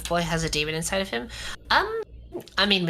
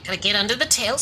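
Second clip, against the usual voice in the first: ordinary talk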